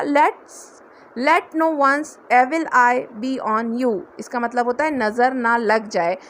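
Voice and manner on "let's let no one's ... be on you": every word drawn out slowly; slow demo phrase. Shown as a demonstration. Teacher slowly models the blessing for learners, drawn out. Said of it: "ये last है मेरा".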